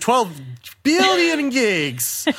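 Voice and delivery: very high, drawn-out mock announcement; mock-epic exaggeration. Co-host topping a joke about download size, mock-epic exaggeration. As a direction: blow it up absurdly, stretching the words high.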